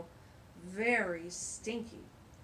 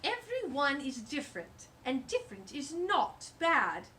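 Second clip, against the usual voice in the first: turtle voice; 5 semitones higher, 6 dB louder than usual. Acted. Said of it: "said Scooter".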